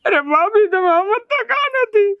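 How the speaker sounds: high, tearful, voice breaking; crying complaint. Acted. Devar sobbing to his sister-in-law about Madhu, high and crying. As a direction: whine it out through sobs.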